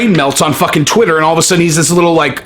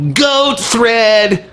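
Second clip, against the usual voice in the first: higher, whiny mock of the tweet; pathetic mock voice; 5 semitones higher than usual. Shown as a demonstration. Vlogger mocking the tweet as pathetic, higher and whiny. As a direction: make it sound pathetic. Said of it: "It sounds pathetic".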